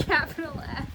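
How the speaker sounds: "Capital F" through laughter; laughing. Real, not acted. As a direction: echo it while cracking up. Echoing while laughing.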